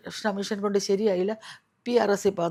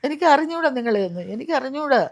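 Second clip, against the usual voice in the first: retold denial, louder and higher by the end; irritated denial; 5 semitones higher, 5 dB louder than usual. A confused grandmother refuses to know her granddaughter, rising irritated denial. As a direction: push the girl away with it.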